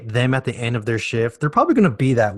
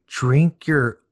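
slow disbelief; 8 semitones higher, much slower than usual. Retold or imitated. Reading disappointing decoder message.

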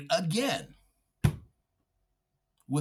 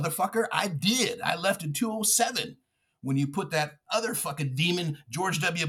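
ordinary talk

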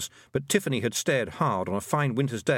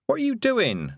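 put-on girl's voice; 12 semitones higher than usual. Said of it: "said Tiffany".

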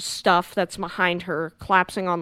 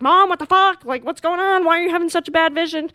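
put-on high, loud panicked teen voice; alarmed daughter; 9 semitones higher, 6 dB louder than usual. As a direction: shake her awake in a panic. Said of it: "is like".

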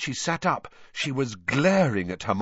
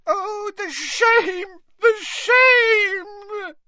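tearful wailing; 6 dB louder than usual. A narrator's reading. Winky mourns Mister Crouch and her shame, wailing tearfully. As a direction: wail with mounting despair. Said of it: "tears leaking down her face".

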